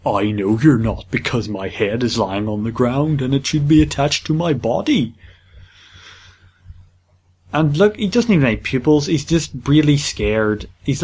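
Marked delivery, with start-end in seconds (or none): 0.1-5.2 imitating the big face. Player voices the big face on a jungle map, hammed-up role. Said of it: "Looks like".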